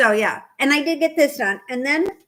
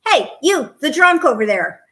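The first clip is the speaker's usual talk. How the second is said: calling out loud across the street; a policeman calls out to a passer-by, loud; 3 semitones higher, 8 dB louder than usual. Retold or imitated. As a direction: shout it across the street at her. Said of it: "and he goes".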